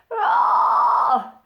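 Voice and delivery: high loud fry scream; short fry scream burst. Shown as a demonstration. Showing the fry screaming method, a scream. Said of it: "more of like a".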